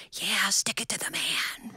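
whispered conspiratorial cheer; silent cheer. Retold or imitated. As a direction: breathe it without voice. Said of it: "give you a silent".

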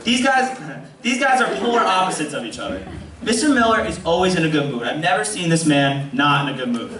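ordinary delivery

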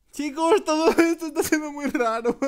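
high, loud yell breaking into laughter; hammed-up freak-out ending in a laugh; panicked shout. Gamer telling viewers this is weird, panicked shouting.

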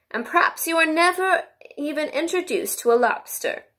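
high-pitched, plaintive storybook turtle; Mock Turtle. A narrator's reading.

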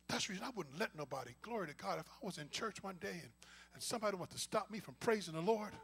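ordinary talk, nothing marked

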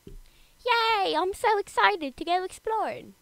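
high, excited British voice; British accent. Acted. Adventurer delighted to go exploring, excited British accent. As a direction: cheer in a put-on British accent. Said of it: "I gave him a British accent".

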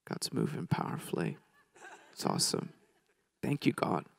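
soft, half-whispered private praise; hushed awe. Retold or imitated. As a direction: imitate a husband quietly thanking God. Brother privately thanking God, retold.

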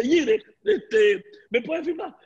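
high, slow put-on fan voice, laughing; girls asking autograph. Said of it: "Y me dice".

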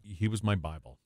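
ordinary talk

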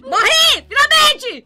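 loud high cheer; triumphant shout. Pretend. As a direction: celebrate the win loudly. Happy to die in game.

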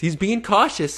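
slow, high mocking drawl. Vlogger mocking the predator, slow high sneer.

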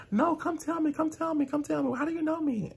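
nagging high whine, mimicking her; mocking high customer voice. Imitating the rude customer begging to know, high and whiny.

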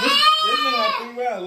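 high excited protest. Real, not acted. Protesting the number of rounds.

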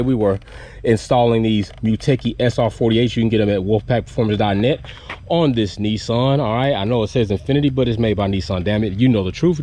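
usual speaking voice

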